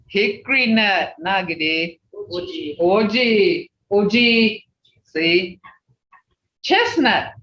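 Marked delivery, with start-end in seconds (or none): none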